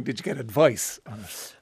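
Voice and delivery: ordinary talk, nothing marked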